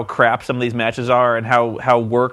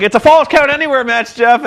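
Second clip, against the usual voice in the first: much higher, loud hammed-up ref shout; excited referee yell; 10 semitones higher, 6 dB louder than usual. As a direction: belt it out like an overexcited referee. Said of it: "the ref's like".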